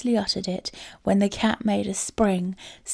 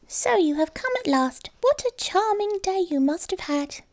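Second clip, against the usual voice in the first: high, light mouse voice, much higher than the narrator; a small house mouse welcoming her friend home; little mouse voice; 11 semitones higher than usual. A narrator's reading. Mouse greets returning cat.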